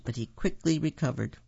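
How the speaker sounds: plain reading voice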